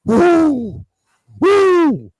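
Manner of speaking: high, loud riled-up shouting; hammed-up rant to a partner; venting to his partner, loud and high; mock angry outburst. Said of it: "And I'm over here like".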